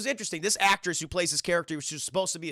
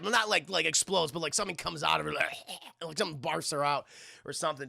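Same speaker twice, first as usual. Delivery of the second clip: ordinary delivery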